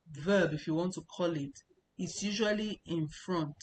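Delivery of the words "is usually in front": ordinary talk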